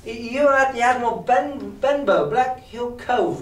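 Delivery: high-voiced boy; boy answering stranger. A narrator's reading. Boy narrator answers the blind beggar.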